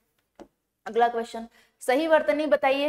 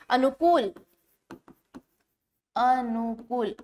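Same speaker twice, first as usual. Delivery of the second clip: अनुकूल stretched out slowly, each syllable apart; slow dictation; 4 dB quieter, much slower than usual. Shown as a demonstration. Teacher writes the word on the board, slowly. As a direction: draw out अनुकूल as you write it. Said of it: "इस प्रकार से लिखा जाता है".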